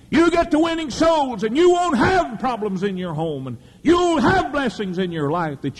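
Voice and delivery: high, ringing preacher's shout; preaching shout. Real, not acted. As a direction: cry it out to the congregation. Preaching at full force.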